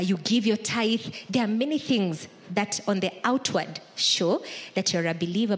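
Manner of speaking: ordinary talk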